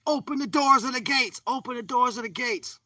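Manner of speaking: high-pitched mock yell. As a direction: yell it insistently, pitched right up. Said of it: "somebody else yelling at your head".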